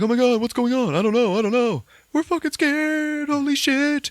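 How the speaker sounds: high, loud, frightened yelling; panicked. Retold or imitated. Two characters meeting in the dark attic, panicked yelling. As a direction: yell in a panic, terrified. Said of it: "They're like".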